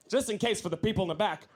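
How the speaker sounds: usual speaking voice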